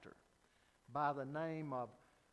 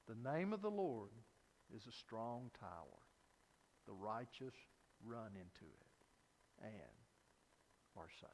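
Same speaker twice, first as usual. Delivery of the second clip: hushed, as if barely heard down a tunnel; soft voice; 9 semitones lower, 11 dB quieter than usual. Retold or imitated. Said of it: "It was a soft voice".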